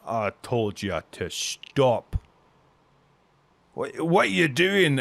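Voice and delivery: drawn-out, slow scolding; a touch of irritation, words dragged out; mildly annoyed dwarf. Acted. Dwarf scolds the intruder, mildly annoyed. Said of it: "looks at you like an annoyance".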